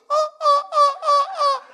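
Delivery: very high, loud barking "oh" sounds; seal barks. Retold or imitated. Pastor imitating his sister-in-law's seal-like laugh, loud high barks. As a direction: bark like a seal. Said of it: "she sounds like a seal".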